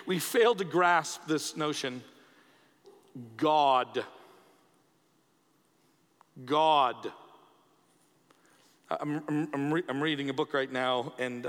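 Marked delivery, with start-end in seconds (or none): 3.4-4.0 GOD said alone, low and forceful; emphatic restatement. Shown as a demonstration. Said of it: "let me re-say this word". 6.4-7.1 GOD said alone, low and forceful; emphatic restatement. Shown as a demonstration. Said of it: "let me re-say this word".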